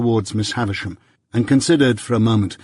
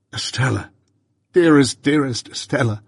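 passionate plea; 4 semitones higher than usual. A narrator's reading. Pip's passionate grief.

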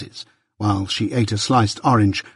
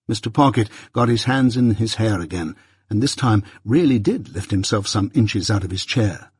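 ordinary delivery

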